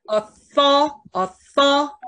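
"Author-" with THOR drawn out high and loud; stress on second syllable. Shown as a demonstration. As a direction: slowly punch the second syllable of "Author-", pitch up. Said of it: "重音在第二个音节". (“Author-”重音落在第二音节，拉长、读高读响)

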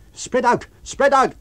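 loud urgent shout. A narrator's reading. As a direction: yell it across the street. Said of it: "cried someone".

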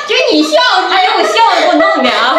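high laughter bursting through words; can't stop laughing while talking; stifled giggles. Real, not acted.

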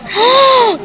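a startled gasp that jumps up high; real gasp of surprise; sharp high gasp. Real, not acted. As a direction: catch your breath in shock. Sudden drop on the ride.

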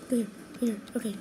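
normal talking voice

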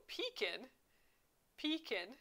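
"pekin" as PEE-kin; grandma's pronunciation. Shown as a demonstration. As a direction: try out "pekin", stressed pee and light kin. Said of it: "my grandma says".

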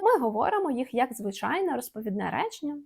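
usual speaking voice

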